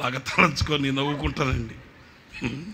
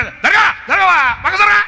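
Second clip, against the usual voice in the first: much higher, louder bossy shout; shouting move aside; 10 dB louder, slower than usual. Retold or imitated. Shoving the friend aside at the generator, loud shout. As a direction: bark him out of the way.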